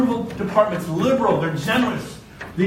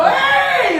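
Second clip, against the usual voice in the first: playful shout; 6 dB louder than usual. Retold or imitated. Calling back at driver.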